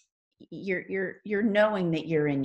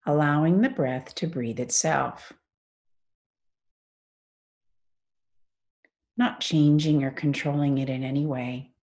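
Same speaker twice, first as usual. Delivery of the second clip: guided meditation. Acted. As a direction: ease them into stillness, phrase by unhurried phrase.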